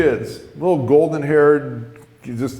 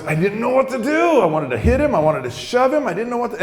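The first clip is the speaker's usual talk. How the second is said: high, worked-up rush of helplessness; flustered, pitched up; frantic frustration; 9 semitones higher than usual. Retold or imitated. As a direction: at your wits' end, voice climbing. Retelling his panic and frustration.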